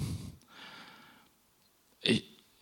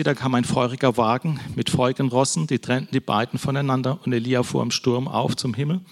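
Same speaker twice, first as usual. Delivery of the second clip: ordinary talk, nothing marked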